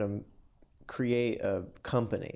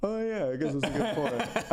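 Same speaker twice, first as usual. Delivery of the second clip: laughing; 4 semitones higher than usual. Retold or imitated. Laughing at own retold reply.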